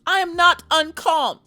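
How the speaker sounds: high, loud denial; mock shrill protest. Dog owner yelling that she is calm, high and loud. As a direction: shout it like an offended diva.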